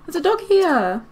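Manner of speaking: gasping, slowed delight at the dog; real little gasp of surprise; surprised gasp. Real, not acted. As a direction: gasp and marvel at the find. Spots dog in game.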